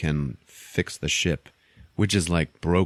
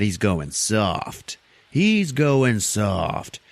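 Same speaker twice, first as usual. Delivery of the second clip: mock jeering; much slower than usual. Crew talking about their captain, slow mocking jeer. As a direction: stretch it into a derisive jeer.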